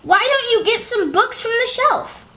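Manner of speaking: a big brother nudging his sister along; Henry suggesting. Acted. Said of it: "Henry suggested".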